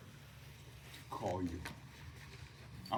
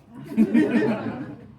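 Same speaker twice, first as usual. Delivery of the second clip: laughter; 7 semitones higher, 6 dB louder than usual. Real, not acted. Laughs at own joke.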